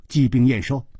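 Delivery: ordinary delivery, nothing marked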